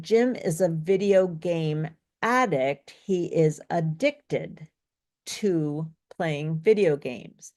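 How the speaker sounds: ADdict stressed first, then slow adDICTed stressed on DICT; deliberate teaching read, punching AD in "addict" and DICT in "addicted"; slowing down to set "addict" against "addicted"; contrasting syllable stress. Shown as a demonstration. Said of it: "stress in a verb on the second".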